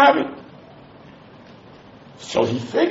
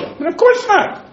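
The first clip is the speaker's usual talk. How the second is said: mock incredulous; 13 semitones higher than usual. Real, not acted. Dismissive high exclamation.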